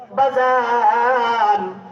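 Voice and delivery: long, stretched-out calling; calling with a lingering vowel; drawn-out call. Acted. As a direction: call out, holding the word long. Host calls out invitation to students.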